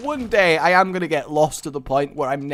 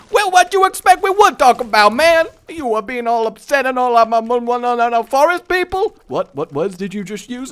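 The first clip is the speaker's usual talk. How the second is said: much higher, loud, fast squeaky voice; forest person; 8 semitones higher, 7 dB louder than usual. Retold or imitated. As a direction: squeak it like an indignant little forest creature. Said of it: "they'll look at me like".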